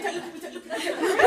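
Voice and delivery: high, loud, giggling plea; nervous giggles. Retold or imitated. Speaker quoting her sister at the ceremony, giggling nervously. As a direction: imitate a sister who can't stop giggling. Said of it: "she had the nervous giggles".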